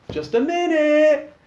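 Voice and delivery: high, loud drawn-out call; shouting. A narrator's reading. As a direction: shout it to the door. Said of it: "he shouts out".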